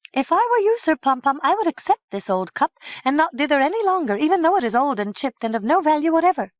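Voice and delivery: high, loud, fast urging; high and fast, pressing him to decide; urgent princess. Acted. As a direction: hurry him to accept. Madook urges Sir Pompom.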